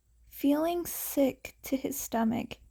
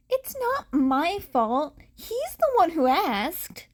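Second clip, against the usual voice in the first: higher, louder excuse; defensive protest; 5 semitones higher, 3 dB louder than usual. Acted. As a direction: protest it isn't her fault. Uraraka defends herself.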